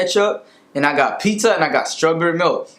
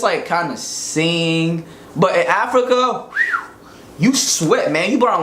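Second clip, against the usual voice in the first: ordinary talk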